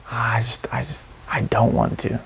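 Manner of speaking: hushed, near-whispered reluctance; reluctant inner voice. Acted. Meditation guide voices the resisting mind, whispered reluctance. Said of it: "what is saying".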